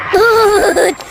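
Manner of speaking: high, loud scream of "भूत"; terrified shout. Acted. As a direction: yell it out in sheer terror. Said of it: "मैं अचानक से चिल्लाया".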